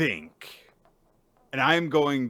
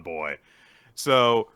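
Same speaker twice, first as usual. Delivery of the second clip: ordinary delivery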